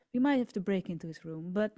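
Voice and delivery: usual speaking voice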